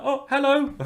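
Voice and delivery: mock phone voice, pitched right up; tipsy phone greeting. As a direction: act out a merry, slightly drunk hello. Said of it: "he's a little bit drunk".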